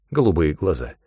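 usual speaking voice

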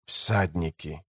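one low, quiet clipped word; low, hushed alarm; curt warning. Acted. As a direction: drop it low and soft as a warning. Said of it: "коротко бросил".

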